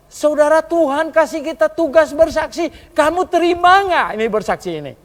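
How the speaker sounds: loud, high, forceful preaching; full-force preaching. Acted. Preacher challenging the congregation to bear witness, shouting. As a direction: drive the call home to the church.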